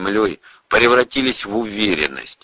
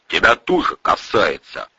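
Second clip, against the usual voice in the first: ordinary delivery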